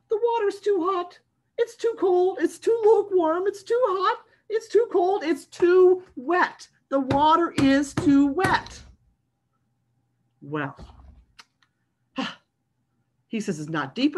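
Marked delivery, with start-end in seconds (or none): 0.1-8.8 high, louder, complaining pigeon voice; whiny pigeon. Acted. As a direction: whine every excuse like a fussy bird.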